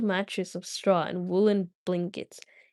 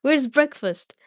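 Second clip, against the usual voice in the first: eager; 6 semitones higher, 5 dB louder than usual. Acted. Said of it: "he cried".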